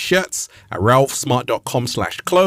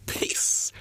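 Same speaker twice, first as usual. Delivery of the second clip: unvoiced whisper; hushed farewell; whispered peace; 11 dB quieter than usual. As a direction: breathe a final goodbye. Whispered sign-off.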